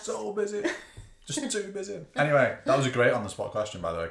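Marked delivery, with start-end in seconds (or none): 0.0-2.0 high, hammed-up self-importance; mock busy boasting. Host joking about having better places to be, mock self-important. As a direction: overplay being rushed off your feet.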